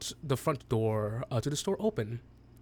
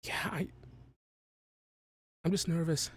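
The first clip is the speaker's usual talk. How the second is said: hesitant, softer, stumbling nervous confession; a teacher losing her nerve, quietly; nervous professor; 6 dB quieter than usual. Acted. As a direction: professor on the edge of a breakdown, hesitant and soft. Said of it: "she's having a professor breakdown".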